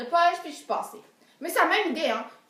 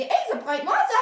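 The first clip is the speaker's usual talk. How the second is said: excited thought; 9 semitones higher, 6 dB louder, much faster than usual. Retold or imitated. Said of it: "je me suis dit".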